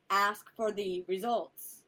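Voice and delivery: each word spelled out slowly; slow it down to stress it; slow and deliberate. Real, not acted.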